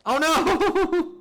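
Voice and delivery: high, loud delighted "oh no"; thrilled high cry; excited squeal. Real, not acted. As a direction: squeal at the screen. Reacting to game moment.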